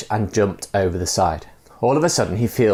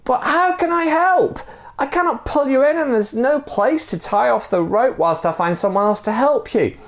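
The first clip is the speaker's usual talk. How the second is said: exasperated yell. Acted. Man arguing down over the edge, high exasperated yell. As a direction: argue it out in frustration. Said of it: "yelled to him again".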